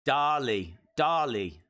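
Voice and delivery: measured DAH-lee for "Dali", dropping at the end; Mexican pronunciation. Shown as a demonstration. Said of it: "they say Dali".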